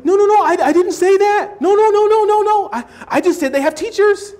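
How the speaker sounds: mock frantic backpedalling, high and loud; panicked denial. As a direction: shout the denial in a high rush. Acted denial in dialogue.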